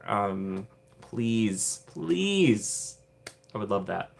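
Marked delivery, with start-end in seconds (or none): none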